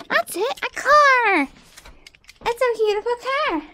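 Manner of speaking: high excited exclaiming; light up at the perfect find; high-pitched delight; delighted discovery. Acted. Amy finds a toy.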